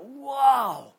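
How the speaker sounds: loud, high mimicry of an amazed boss; boss's amazed whoa. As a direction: belt out your boss's big, swooping reaction. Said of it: "he used to talk like that".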